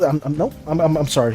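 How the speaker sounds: ordinary delivery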